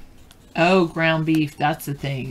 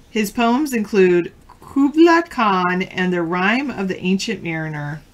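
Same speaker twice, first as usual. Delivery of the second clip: louder quiz host reading the question; trivia question read; 4 semitones higher, 5 dB louder than usual. Acted. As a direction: read it out clearly to the players.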